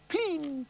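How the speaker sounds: high falling clean; water drop. Retold or imitated. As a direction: drip it high. Water drip imitated.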